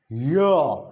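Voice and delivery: Y as "yuh", not the letter name; isolated "yuh" for Y; voiced consonant demo. Shown as a demonstration. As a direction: sound out Y with a short vowel.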